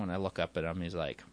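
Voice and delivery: ordinary delivery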